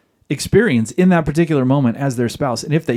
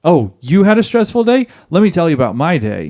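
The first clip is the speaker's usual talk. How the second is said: higher, louder mock retort; wife shooting back; 5 semitones higher, 4 dB louder than usual. Wife firing back at husband's stressful day, combative retort. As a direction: fire it back as a challenge. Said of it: "she shoots back at you and says".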